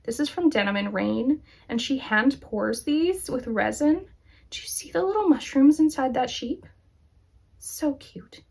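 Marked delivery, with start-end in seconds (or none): 4.5-8.4 hushed whisper over something tiny and cute; delighted whisper. Real, not acted. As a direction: lean in and whisper as if sharing a secret. Showing tiny mushrooms in resin sheep.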